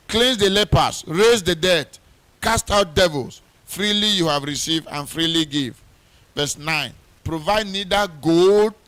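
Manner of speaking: loud, ringing pulpit preaching; fervent wedding sermon preaching. Acted. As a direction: preach it out with full force. Preaching at full force, reading scripture.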